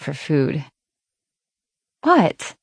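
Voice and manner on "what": high startled "What?". Acted. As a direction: caught off guard, jump up high. Megan caught moaning, defensive.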